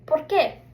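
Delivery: ¿Por qué? said por KEH for the class to repeat; Spanish pronunciation. Shown as a demonstration. Teacher says ¿Por qué? for students to echo. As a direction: model it once. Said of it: "So repeat after me".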